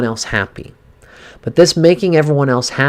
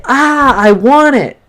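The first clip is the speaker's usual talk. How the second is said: much higher and louder, venting frustration; demo angry outburst; 10 semitones higher, 6 dB louder, slower than usual. Shown as a demonstration. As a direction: vent at the pillow like a frustrated child. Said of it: "you can cry and you can just say".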